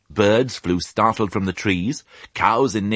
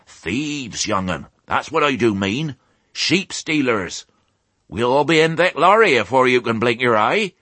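put-on rustic old sheep; 6 semitones higher, 3 dB louder than usual. A narrator's reading. Old sheep warns piglet.

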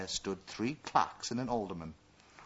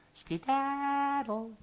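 high, slow, drawn-out dismissal; cartoon princess; much slower than usual. A narrator's reading. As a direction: stretch it out, shooing him away.

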